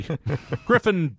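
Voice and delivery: slow, mocking drawl of the name; mocking the house name; drawn-out mockery. Real, not acted. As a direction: stretch the name out to mock it.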